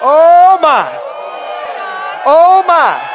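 loud high cry of oh my; excited shout. Real, not acted. Preacher overcome by the coming series, excited shouts. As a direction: shout it out, rising each time.

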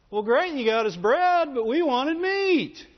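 high, slow whining complaint; mock whiny Israelites. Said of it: "with the whiny voice".

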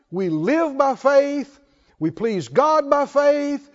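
fervent preaching. Acted. Preacher rousing the saints with a faith litany, fervent. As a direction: build the list like a revival preacher.

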